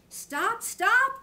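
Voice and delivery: high loud call of stop. A narrator's reading. Cow in the pasture calling out for him to stop. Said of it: "called the cow".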